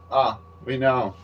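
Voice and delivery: ordinary delivery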